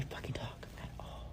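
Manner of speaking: hushed as if still bugged; whispered telling. Real, not acted. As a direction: whisper it like the room is wired. Can't talk in bugged apartment.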